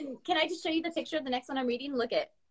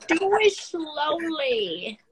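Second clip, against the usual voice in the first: slow, stretched-out words, pitched up and louder; drawn-out teasing; 4 semitones higher, 5 dB louder, much slower than usual. Pretend. Co-host chiming in after the erotic poem, drawn-out teasing. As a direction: draw every word right out, playfully.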